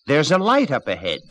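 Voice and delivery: a rooster calling down from a tree. Acted. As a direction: call it down from high up. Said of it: "called down".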